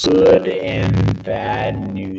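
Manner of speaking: garbled robotic audio; glitchy broken-up robot sound. Real, not acted. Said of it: "You sound like a robot".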